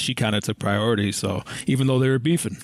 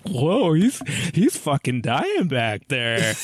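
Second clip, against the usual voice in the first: mock casual shock; 3 semitones higher, much slower than usual. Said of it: "She's like".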